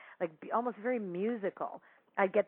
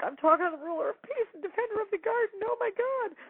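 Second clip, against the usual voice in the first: much higher, thrilled disbelief; amazed gushing; 14 semitones higher than usual. Retold or imitated. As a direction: squeal it out in wonder. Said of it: "I'm like".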